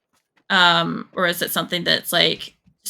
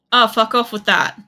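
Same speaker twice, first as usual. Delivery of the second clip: louder, exasperated curse; annoyed outburst; 3 semitones higher, 5 dB louder than usual. Real, not acted. Player cursing the same setback in the game, openly annoyed. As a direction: curse it out loud in real irritation.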